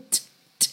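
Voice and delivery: unvoiced clicks of "t, t"; percussive t sound. Shown as a demonstration. Teacher demonstrating the percussive T sound. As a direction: tap out a crisp "t, t". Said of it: "som um pouquinho percussivo".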